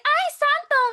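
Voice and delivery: high, loud mock alarm; imitating a startled customer; alarmed exclamation. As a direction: gasp out the surprise.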